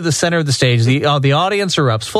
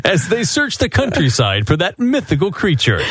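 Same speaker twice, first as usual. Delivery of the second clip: trailer voice. Acted. Spoof show trailer voiceover.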